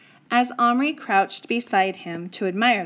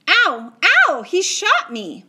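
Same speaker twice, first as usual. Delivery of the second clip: loud, high yelps of pain; boy hit by a tiny bullet, screaming; 10 semitones higher, 8 dB louder, slower than usual. A narrator's reading. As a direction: cry out as if just shot. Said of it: "Patrick screamed".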